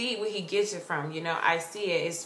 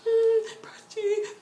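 scared whimper; 13 semitones higher than usual. Retold or imitated. Fan grabbing friend's pants, scared whimper. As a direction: re-enact whimpering his name. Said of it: "I was so scared to talk to him".

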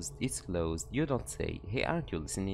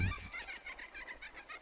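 squeaky high laugh; 9 dB quieter than usual. Acted.

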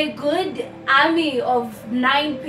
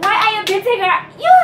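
higher, louder upset shout; mom's angry demand; 8 semitones higher, 7 dB louder, faster than usual. Retold or imitated. Mother shouting at the man beating her daughter. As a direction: confront him for hitting your daughter. Mom confronts attacker.

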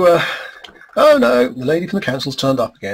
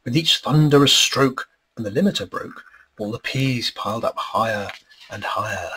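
lilting storybook read-aloud, higher and bouncy; rhyming story narration; 4 semitones higher, 3 dB louder than usual. A narrator's reading. Author reading his rhyming book aloud to an audience, lilting storybook voice. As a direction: read the verse like a children's book.

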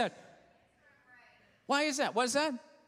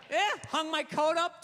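normal talking voice

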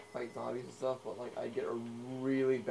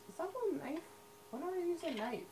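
much higher, put-on squeak of confusion; squeaky mock voice; 5 dB quieter than usual. Gamer voicing his character's confusion over a knife, high squeaky mock voice. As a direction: play a baffled little character, pitched way up.

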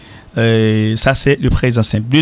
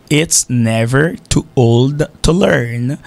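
slow model reading; much slower than usual. Shown as a demonstration. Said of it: "teacher repeats the English proverb".

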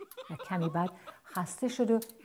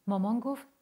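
ordinary delivery

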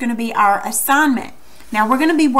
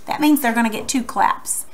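normal talking voice

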